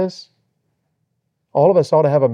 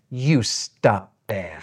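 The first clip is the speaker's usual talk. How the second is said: very slow, low and soft flirty reproach; coy mock scolding; 6 semitones lower, 5 dB quieter, much slower than usual. Old wife to husband who patted her, slow coy scolding. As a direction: draw it out low, playfully chiding. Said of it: "here's what she said".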